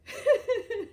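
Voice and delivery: quick giggle mid-story; real laugh at own story; amused laugh. Real, not acted. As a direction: break into a short, high laugh.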